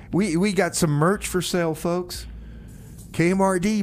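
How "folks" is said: normal talking voice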